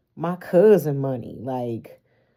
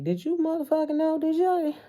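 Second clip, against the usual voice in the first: high, fast, outraged gossiping relative; indignant relatives at Thanksgiving; 8 semitones higher than usual. Retold or imitated. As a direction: squeal it like a scandalized auntie at dinner. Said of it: "They gonna be at Thanksgiving like".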